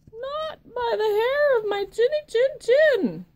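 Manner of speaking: high, loud squeaky pig voice; little pig voice. Acted. Little pig defiantly refusing the wolf, high and loud.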